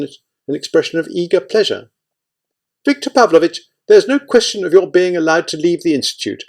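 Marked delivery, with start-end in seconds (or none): 2.8-6.4 high, raised, stern rebuke. A narrator's reading. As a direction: lay down the law sternly. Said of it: "Kovchenko said sternly".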